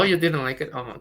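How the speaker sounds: ordinary talk, nothing marked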